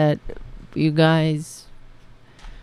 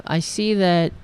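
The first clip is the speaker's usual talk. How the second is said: ordinary talk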